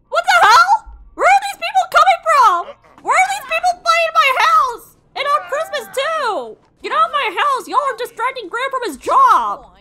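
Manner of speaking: very high, loud furious yelling; outraged shriek. Real, not acted. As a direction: shriek them out of the house. Strangers invade the house.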